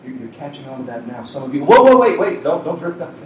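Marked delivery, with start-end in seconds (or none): none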